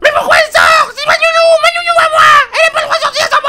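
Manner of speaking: shrill very high screaming brat; child's tantrum. Acted. Laurie throwing a scene upstairs, screaming. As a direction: wail like a spoiled little girl. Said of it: "Laurie faire une scène".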